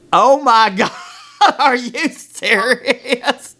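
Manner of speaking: high, slow laughing oh my god; laughing disbelief. Retold or imitated. Laughing disbelief at being called in over a book. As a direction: drag it out, laughing, stunned. Reacting to the absurd complaint.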